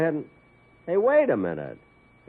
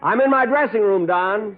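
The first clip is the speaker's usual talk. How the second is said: loud, higher call from the dressing room; 8 semitones higher, 5 dB louder than usual. Acted. Calling out to Don.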